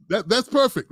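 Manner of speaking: a chuckle running into the words; amused, pleased with the answer; laughing approval. Real, not acted. Host laughing at the guest's blunt no, pleased.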